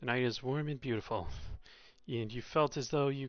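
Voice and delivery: calm read-aloud narration voice; story narration. A narrator's reading. Streamer narrates the visual novel to viewers, calm and even. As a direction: read the scene evenly to the chat.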